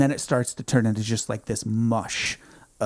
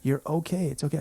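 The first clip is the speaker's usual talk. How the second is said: soothing nurse; 5 semitones higher, 6 dB quieter, faster than usual. Retold or imitated. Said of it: "she's just like".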